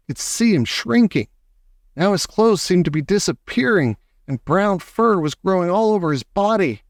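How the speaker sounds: usual speaking voice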